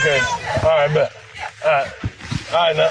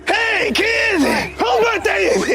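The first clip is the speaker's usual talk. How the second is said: party clown; much slower than usual. Acted. Homie the Clown greeting the kids at a party, high slow shouting. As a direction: boom it out like a creepy party clown. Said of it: "to be Homie the Clown".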